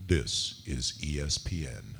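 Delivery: low, resonant announcer voice; James Earl Jones impression. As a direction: drop it deep for a network ident. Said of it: "wanted me to do a James Earl Jones".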